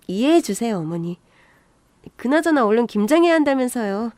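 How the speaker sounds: ordinary delivery, nothing marked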